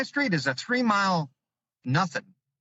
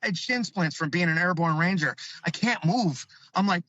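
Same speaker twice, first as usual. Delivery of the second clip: ordinary delivery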